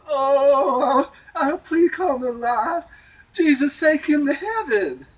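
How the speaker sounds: high, loud and slow, a different hammed-up voice; preacher praying. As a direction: ham up a preacher's fervent prayer over the rat. Preacher hugs rat and prays.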